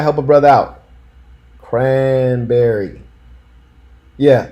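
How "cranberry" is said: "Cranberry" very slowly in plain American English; "Cranberry" drawn out with an English r; English pronunciation. Shown as a demonstration. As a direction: stretch "Cranberry" out, KRAN-berry, flat English vowels. Plain English take of the word.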